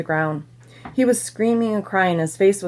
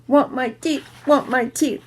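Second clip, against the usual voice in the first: mock scream; 5 semitones higher than usual. A narrator's reading. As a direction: demand it like an upset little kid. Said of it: "Fudge started to scream".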